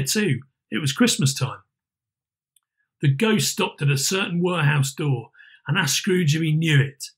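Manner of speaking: ordinary delivery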